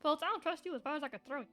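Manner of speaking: normal talking voice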